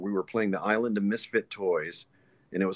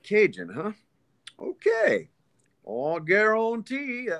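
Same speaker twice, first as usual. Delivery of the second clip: Cajun alien voice, higher, drawn out slowly; Cajun accent; 12 semitones higher, slower than usual. Shown as a demonstration. Auditioning for director, Cajun accent alien read. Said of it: "if this alien were from Louisiana".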